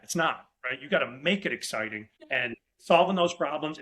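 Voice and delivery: ordinary talk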